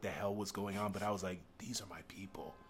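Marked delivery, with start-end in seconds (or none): 1.6-2.6 whisper. Retold or imitated. Whispered inner realization.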